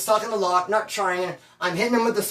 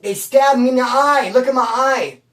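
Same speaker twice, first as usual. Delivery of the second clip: mock loud complaint; 4 semitones higher, 6 dB louder than usual. Inmate complaining to cops.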